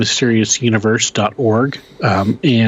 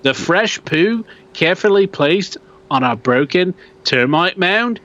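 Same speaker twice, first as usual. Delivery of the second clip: put-on Australian accent; 4 semitones higher than usual. Host reading a Yowie sighting post in a mock Australian accent. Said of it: "I think I'm getting better".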